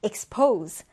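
"expose" stressed on -POSE; light ex-, strong -pose in "expose"; stress on second syllable. Shown as a demonstration. Teacher models the next word for learners, "expose" stressed at the end. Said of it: "디귿절의 강세".

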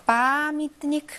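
빠미트니크 with a long, high stressed 빠; stress on first a. Shown as a demonstration. Teacher showing where stress falls, drawn-out 빠 in 빠미트니크. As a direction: slowly draw out the 빠 of 빠미트니크. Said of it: "강세가 아에 있습니다".

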